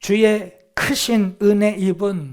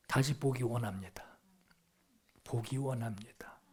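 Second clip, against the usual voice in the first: low, near-whispered prayer; hushed plea; 7 semitones lower, 13 dB quieter than usual. Acted. Blind man asks the Lord for sight, hushed plea. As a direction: drop to a soft, low plea. Acted plea narrowing to one wish.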